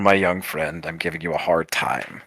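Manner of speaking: low, soft reassurance to a youngster; calming elder to young performer; circus leader, reassuring. Acted. Game master plays circus leader.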